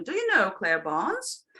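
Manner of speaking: "Barnes" swooping up at the end; rising question. Shown as a demonstration. Teacher attracting attention and inviting a reply, rising intonation. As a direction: raise "Do you know Claire Barnes" to invite an answer. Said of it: "the intonation goes up".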